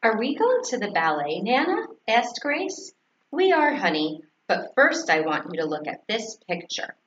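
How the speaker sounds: usual speaking voice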